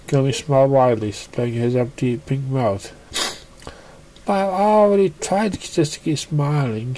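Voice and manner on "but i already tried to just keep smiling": higher, pleading; desperate Pinkie. A narrator's reading. Pinkie pleading with Gummy, desperate. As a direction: beg as if out of ideas. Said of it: "said Pink desperately".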